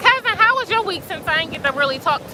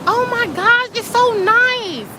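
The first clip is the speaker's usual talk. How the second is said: much higher, slow, marvelling; delighted awe; 9 semitones higher, slower than usual. Real, not acted. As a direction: savour the beauty, drawn out. Admiring the glowing waves.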